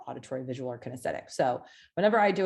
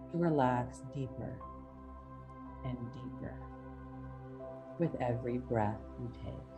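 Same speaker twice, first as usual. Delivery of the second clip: hypnotic induction; 5 dB quieter than usual. Acted. Reads hypnotic induction over music.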